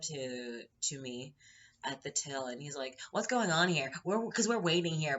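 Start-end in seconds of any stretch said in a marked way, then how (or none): 3.1-5.2 loud, higher mock complaint; raised-voice mock demand; impatient customer. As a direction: imitate a pushy man in the queue. Acting out impatient man in line.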